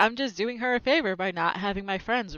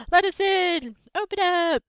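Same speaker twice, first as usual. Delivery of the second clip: loud, high calls through the glass; shouting to be let in; 10 semitones higher, 5 dB louder than usual. Acted. Friends at the window yelling to be let in, loud and high. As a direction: yell it high and loud from outside.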